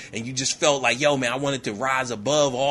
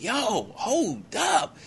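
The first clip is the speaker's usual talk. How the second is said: very slow, high, stretched-out protest; alarmed hold-up; 9 semitones higher, much slower than usual. Retold or imitated. Confused soldier stopping her before the blast, drawn-out and high. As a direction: drag each word out in mock disbelief. He balks before the plane explodes.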